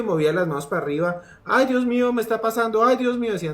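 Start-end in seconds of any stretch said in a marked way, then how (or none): 1.4-3.3 high-pitched imitation of a panicking boy; niño asustado. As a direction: plead in a mock frightened child voice. Voicing panicked boy on rollercoaster. (voz aguda imitando a un niño asustado)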